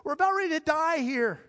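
frantic mock terror; panicked disciples. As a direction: disciples shrieking at calm Jesus. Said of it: "And they say".

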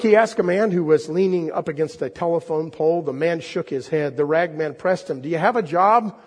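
expressive storyteller read aloud; story reading. Acted. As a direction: draw the congregation into the tale. Preacher reads The Rag Man aloud.

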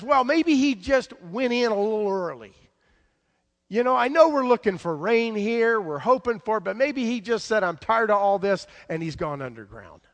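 high, loud mock-helpful taunt. Elijah mocks the prophets about their missing god, taunting. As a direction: needle them with loud, high-pitched suggestions. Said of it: "taunts them until they're exhausted".

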